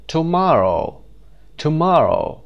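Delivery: "Tomorrow" with MOR high and loud; stress on second syllable. Shown as a demonstration. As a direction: punch the middle of "Tomorrow". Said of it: "Underline the stressed syllable".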